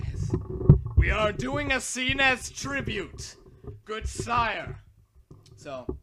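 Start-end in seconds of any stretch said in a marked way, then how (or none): 0.1-4.8 loud, high, slow herald announcement; hammed-up loud courtly declaration; mock proclamation. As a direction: proclaim it grandly to a lord. Mock-formal proclamation to co-host.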